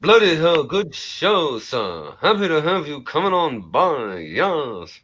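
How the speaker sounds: hammed-up toff; plummy English gentleman; posh Brit. Posh English gent impression.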